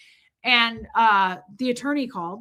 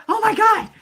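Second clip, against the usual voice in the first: loud, high exclamation; hammed-up alarm over the phone; mock panic; 11 semitones higher, 8 dB louder than usual. Realizes the phone is soaked.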